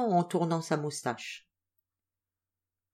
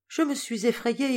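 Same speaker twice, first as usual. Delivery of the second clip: plain reading voice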